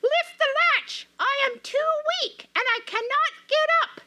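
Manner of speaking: put-on thin doubtful old granny voice; grandmother voice. Weak grandmother calling from bed.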